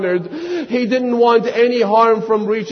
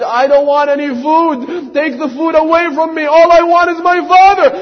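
put-on higher child voice, rising to a loud cry; child's protest; 8 semitones higher, 5 dB louder, faster than usual. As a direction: push away the food, then cry out for her father. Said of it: "She said".

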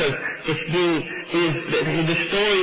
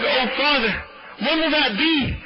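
high, anguished daughter's question; mock weeping plea; 10 semitones higher than usual. Daughter asks her father about her son's death, tearful plea. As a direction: plead upward in retold grief. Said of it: "she started weeping".

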